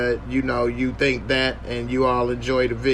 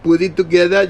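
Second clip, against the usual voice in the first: ordinary talk, nothing marked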